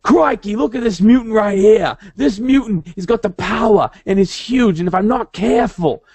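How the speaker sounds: hammed-up Aussie wildlife-host patter, pitched high; Australian-accented Wolverine. Joking as Australian Wolverine spotting a mutant, hammed-up Aussie accent. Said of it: "he ended up with an Australian accent".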